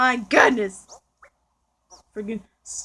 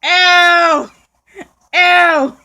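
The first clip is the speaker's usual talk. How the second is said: loud, disgusted shout; 3 semitones higher, 9 dB louder than usual. Real, not acted. Disgusted reaction in game.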